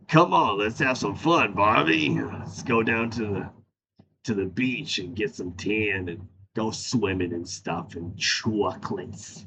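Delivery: a hint of a low crooning lover, slowing at the end; half-hearted smooth Ken voice; lightly voiced Ken. Inviting Barbie to the beach, lightly crooned Ken. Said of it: "how Ken opens up".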